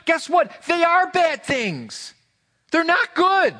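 high, loud, insistent declaration; emphatic preaching. Real, not acted. Preacher to congregation, high and loud insistence. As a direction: drive it home that bad is bad.

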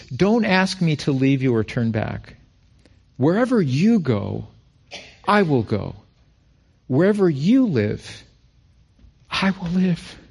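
preacher reading Ruth's vow, rising with feeling; scripture reading. Acted. Preacher to church, reading Ruth's vow with fervor. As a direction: read the vow with building passion.

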